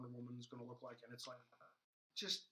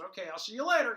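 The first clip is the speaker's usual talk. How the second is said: friendly Flash farewell; 7 semitones higher, 12 dB louder than usual. Retold or imitated. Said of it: "He goes".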